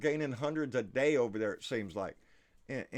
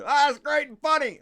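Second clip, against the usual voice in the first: mock fan; 12 semitones higher, 11 dB louder than usual. Mocks viewers' reaction to rant.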